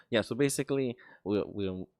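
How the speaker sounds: ordinary talk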